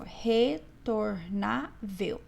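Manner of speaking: RETORNÁVEL very slow, split re, tor, ná, vel; syllable by syllable. Shown as a demonstration. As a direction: say "retornável" in four separate syllables, pausing after each. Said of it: "Separe as sílabas".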